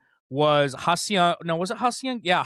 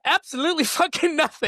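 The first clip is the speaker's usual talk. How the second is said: laughing; 12 semitones higher than usual. Real, not acted. Laughing punchline.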